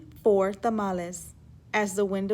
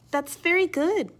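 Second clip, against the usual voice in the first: higher, warm-voiced mother praising; mother's praise; 8 semitones higher, 3 dB louder than usual. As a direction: sing out the praise like a proud mom. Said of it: "her mother said".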